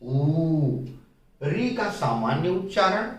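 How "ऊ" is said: ऊ held long, double the उ. Shown as a demonstration. Teacher showing children the long vowel: ऊ drawn out. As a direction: stretch ऊ out slowly. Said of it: "ऐसे ही उ, ऊ".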